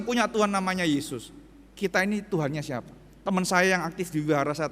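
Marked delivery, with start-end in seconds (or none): none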